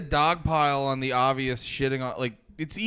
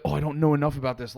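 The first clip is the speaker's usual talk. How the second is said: quick self-talk; 3 semitones lower, faster than usual. Retold or imitated. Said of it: "I constantly will be like".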